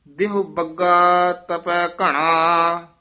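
ordinary talk, nothing marked

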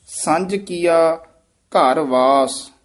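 ਸੰਜ ਕੀਆ ਘਰ ਵਾਸ very slow, ਸੰਜ clear; slow model read. Shown as a demonstration. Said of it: "ਸੰਜ ਬੋਲਣਾ ਹੈ".

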